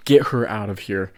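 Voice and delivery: imitating an angry man taking charge; assertive, authoritarian. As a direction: give the order with authority. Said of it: "a, assertive, authoritarian".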